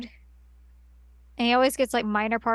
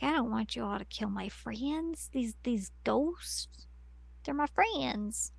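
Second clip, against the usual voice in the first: much quieter, acted plea; soft pleading; 10 dB quieter than usual. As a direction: plead softly, stretching the last words. Acting Mary pleading for her friends.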